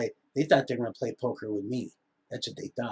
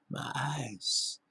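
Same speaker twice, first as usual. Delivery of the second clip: whisper; 9 dB quieter than usual. Real, not acted. Rambling vlog, eyes remark.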